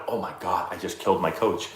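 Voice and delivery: soft stunned whisper-like aside; horrified gasp. Retold or imitated. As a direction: say it under your breath in shock. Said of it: "and I went".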